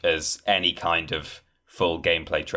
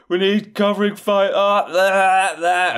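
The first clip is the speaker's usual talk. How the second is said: much higher, louder mock soldier shout; imitated radio yell; 13 semitones higher, 7 dB louder than usual. Host imitating soldiers yelling in the trailer, loud shout. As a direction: yell over the gunfire on the comms. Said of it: "people yelling over the comms".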